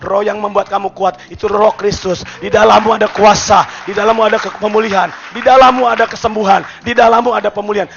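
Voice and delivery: loud, rising preacher's proclamation; full-force preaching. Acted. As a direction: proclaim it to the whole church.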